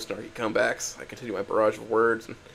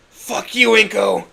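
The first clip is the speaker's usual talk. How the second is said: shrieked, much higher and louder curse; furious scream; 10 semitones higher, 11 dB louder, much slower than usual. Acted. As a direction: scream it at him at full force. Olivia screams back at Inko.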